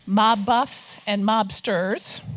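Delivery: spelled out slowly for effect; slow punchline. Real, not acted. Author revealing her real readers to the crowd, slowly, deadpan. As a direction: land each word slowly, deadpan. Punchline about the book's audience.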